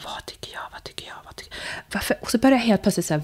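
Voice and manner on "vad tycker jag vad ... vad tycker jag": whispered self-question. Retold or imitated. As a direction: whisper to yourself, fading to almost nothing. Wondering to herself on way home.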